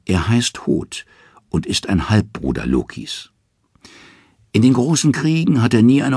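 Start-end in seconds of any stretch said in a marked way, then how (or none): none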